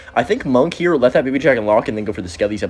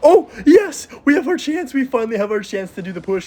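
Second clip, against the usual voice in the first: thrilled; 11 semitones higher than usual. Real, not acted. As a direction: celebrate the opening. Finally gets his push.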